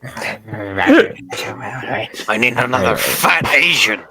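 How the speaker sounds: low, gruff boss voice; Vince impression. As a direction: growl it like a panicked boss. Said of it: "He's like".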